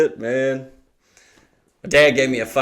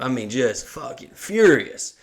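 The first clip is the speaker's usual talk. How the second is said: ordinary delivery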